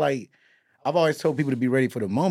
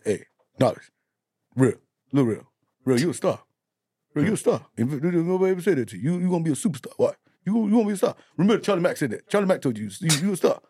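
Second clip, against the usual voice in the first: quoting Charlie Mack; 4 semitones higher, faster than usual. Retold or imitated. Charlie Mack quoted hyping.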